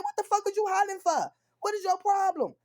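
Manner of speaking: furious high yell; flipping out. Retold or imitated. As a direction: explode at the hollering kids. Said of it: "I'ma flip the fuck out".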